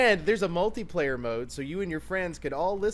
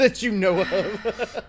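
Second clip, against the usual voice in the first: laughing echo; 6 semitones higher, 5 dB louder, much slower than usual. Real, not acted. Echoes friend's line while laughing.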